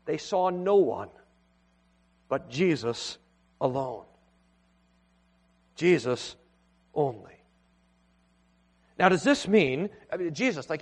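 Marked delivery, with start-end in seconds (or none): 0.0-4.0 weighty, hushed emphasis; emphatic pauses. Shown as a demonstration. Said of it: "the text is emphatic". 5.8-7.4 "Jesus" dropped low and quiet, long pause, then "only" softer still; solemn hushed stress; emphatic. Shown as a demonstration. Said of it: "the text is emphatic".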